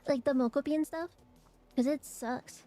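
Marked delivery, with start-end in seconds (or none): none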